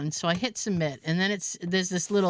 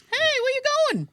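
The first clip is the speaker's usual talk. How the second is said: Bill calling. Retold or imitated. Bill calling her over.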